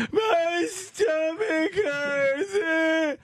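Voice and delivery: put-on very high, very slow childish whine; whiny kid. Kid whining in the broken-down car, high and dragged. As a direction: stretch every word like a pitiful child.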